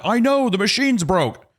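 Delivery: high-pitched mock pleading; frustrated protest. Trenching worker defending himself to the customer, mock frustrated. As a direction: imitate a flustered worker, voice way up. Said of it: "the guy is also frustrated".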